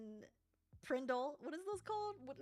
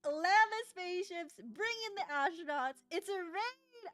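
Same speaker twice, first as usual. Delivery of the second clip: hyped welcome; 6 semitones higher, 5 dB louder than usual. Real, not acted. Welcoming raiders.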